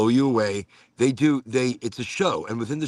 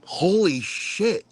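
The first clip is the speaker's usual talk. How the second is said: slow drawn-out high amazement; amazed; 7 semitones higher, much slower than usual. Real, not acted. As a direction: stretch it out, stunned. Amazed at frog sound.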